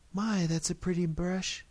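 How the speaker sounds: lightly voiced woman admiring a gift; mild exclamation. A narrator's reading. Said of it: "she exclaimed".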